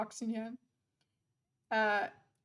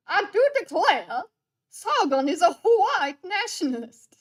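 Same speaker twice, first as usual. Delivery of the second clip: mock Southern belle; 6 semitones higher, 8 dB louder than usual. Mock quote read from chat.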